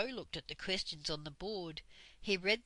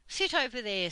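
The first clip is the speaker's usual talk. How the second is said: a sharp, louder command from the stern schoolmistress; stern Dame Slap; 4 semitones higher, 7 dB louder than usual. A narrator's reading. Dame Slap ordering the children to sit, stern. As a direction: bark it at the children like a strict teacher.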